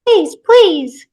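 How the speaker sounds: high, loud squeaky plea; toy echo. Acted. Toy mimics the pleading mother, squeaky echo. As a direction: parrot the begging like a talking toy.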